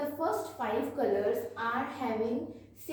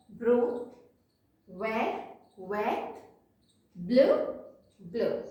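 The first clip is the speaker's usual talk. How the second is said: louder call-and-repeat drill of each color word; teacher's louder repeat-after-me list; revision drill; 4 dB louder, much slower than usual. Shown as a demonstration. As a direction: drill the class through the colors, one by one. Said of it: "let's revise once again".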